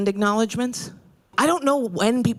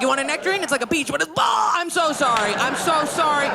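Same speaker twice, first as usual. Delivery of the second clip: panicked blurting; 6 semitones higher than usual. Retold or imitated. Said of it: "I'd be like".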